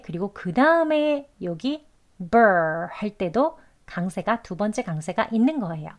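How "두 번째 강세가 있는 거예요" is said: ordinary delivery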